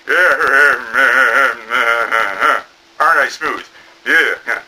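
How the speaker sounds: low mock smooth voice. Mister Smooth Voice showing off, low. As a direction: purr it low and smug. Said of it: "Mister Smooth Voice is like".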